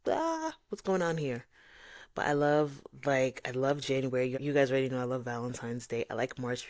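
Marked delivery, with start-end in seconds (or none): 0.1-1.5 suspicious. Retold or imitated. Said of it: "but I'm like".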